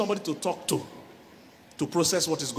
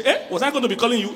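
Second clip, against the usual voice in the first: louder, scoffing comeback; mock dismissive retort; 5 semitones higher, 7 dB louder than usual. Husband scoffing back at his demanding girlfriend. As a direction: brush off her complaint with a scoff.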